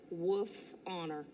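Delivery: usual speaking voice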